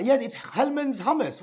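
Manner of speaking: high, slow put-on Iranian accent; lightly offended friend with fake Iranian accent; indignant mock Iranian accent. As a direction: insist in a hammed-up Iranian accent. Accented friend insists on his hummus.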